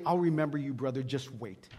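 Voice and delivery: put-on low, fading drunken threat; menacing drunk. Drunk man on the couch threatening, low and quiet. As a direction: mutter a grudge as it trails away.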